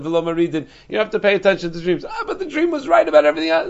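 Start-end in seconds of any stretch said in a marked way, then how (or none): 2.1-3.7 high, fast, protesting "but the dream was right"; incredulous objection. Acted. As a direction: raise it like a challenger pushing back. Imagined objection to the ruling.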